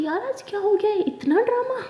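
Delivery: high-pitched, giggly astonishment; squeaky, tickled amazement; amused disbelief. Acted. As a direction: exclaim at the drama, breaking into laughter.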